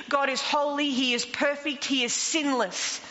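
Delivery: ordinary talk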